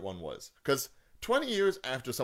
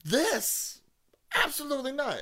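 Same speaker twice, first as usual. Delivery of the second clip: high, indignant protest; indignant disbelief; 14 semitones higher than usual. Real, not acted. Ranting to co-host about a sequel, indignant. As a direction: pitch it way up in disbelief. Exasperated movie rant.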